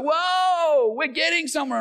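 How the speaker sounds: very high, loud celebratory whoop; acted burst of excitement; elated cheer. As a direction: whoop as if on a winning roll. Acting out excitement after victory.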